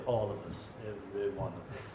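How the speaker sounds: low, soft sneering banker voice; mocking the banks. Mocking banks turning away small customers, low and soft. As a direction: send them away like a smug banker. Mock bank voice dismissing customers.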